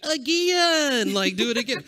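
loud, high Southern "Again!"; imitating daughter's Southern accent. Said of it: "Southern accent".

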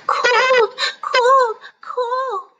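cold babies complaining to Jack; shivering babies. Acted. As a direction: shiver through the words. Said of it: "shivered the babies".

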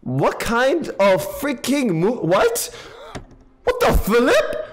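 high, slow drawn-out disbelief; baffled. Real, not acted. Gamer watching the game do something absurd, baffled. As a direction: stretch it out in total disbelief.

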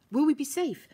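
a scared gasp of a question; gasping in fright. Acted. Violet to Herbie while fleeing, breathless and scared. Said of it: "Vi gasps".

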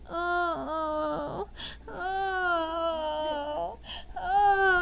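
put-on a small child copying mom's groan; toddler imitating squeal. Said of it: "he's going".

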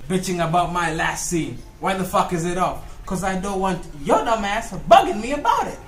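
usual speaking voice

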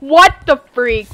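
loud, startled protest; sudden loud gamer reaction; startled outburst. Real, not acted. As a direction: blurt it out in loud disbelief. Startled shout during a game.

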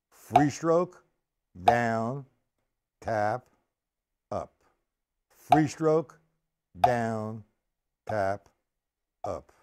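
one term per beat, pauses between; name each stroke in time as you play it; calling out strokes. Shown as a demonstration. Drum teacher demonstrating the stroke sequence, spaced rhythmic call-out.

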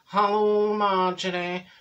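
slow, droning monotone with stretched-out words; lugubrious, level phone drawl; droopy Dorothy. Acted. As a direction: drag every word out like a droopy misery. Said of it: "Droopy Drawers, right, she phones and she says".

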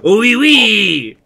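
high, loud mock French accent; hearty laugh into a hammed-up French accent; cartoon Frenchman's French accent; Pierre. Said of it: "he'd be like".

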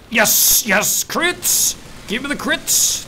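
high, loud cheering; excited shouting. Real, not acted. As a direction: cheer for the crits. Excited about crits.

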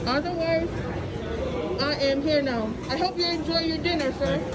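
ordinary talk

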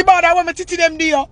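high heated complaint; high heated rant. Real, not acted. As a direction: fling it at her, worked up. Ranting about rival's jealousy.

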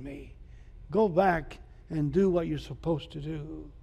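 low, soft, gentle voice of God; still small voice. Acted. God speaking softly to the runaway Elijah, low and quiet.

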